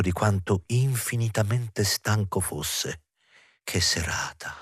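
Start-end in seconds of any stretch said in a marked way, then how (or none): 3.6-4.6 soft, low, tired aside; low murmur to oneself; weary thought. A narrator's reading. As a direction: think it aloud, drained and hushed. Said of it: "pensò".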